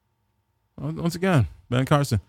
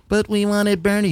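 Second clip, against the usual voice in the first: mock whiny voter; 6 semitones higher than usual. Mocking voters who wanted Bernie.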